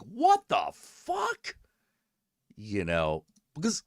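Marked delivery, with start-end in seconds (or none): 0.0-1.6 very high, slow drawn-out cry; stunned exclamation. Retold or imitated. Reacting to the whole story in shock. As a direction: let the shock stretch out. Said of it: "it was a whole, like".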